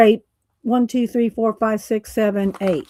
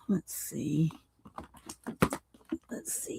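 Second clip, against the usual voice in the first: whispered to herself; 3 semitones lower, 13 dB quieter than usual. Real, not acted. Crafter muttering while searching paints.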